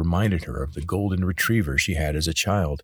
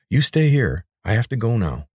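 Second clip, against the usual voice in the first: firm command; 5 semitones higher, 4 dB louder than usual. Acted. Commanding the creature to stay.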